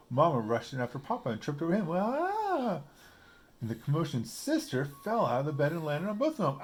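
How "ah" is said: high short ah as she falls; falling cry. A narrator's reading. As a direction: cry out mid-tumble. Mama trips over Papa.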